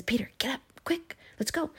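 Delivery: urgent hushed whisper, high; an angel hissing at Peter to wake. Acted. Angel whispering to sleeping Peter, urgent.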